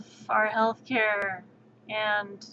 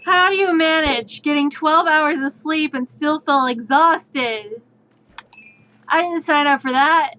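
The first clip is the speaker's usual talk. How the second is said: exasperated; 4 semitones higher, 6 dB louder than usual. Real, not acted. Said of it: "exasperated rhetorical question".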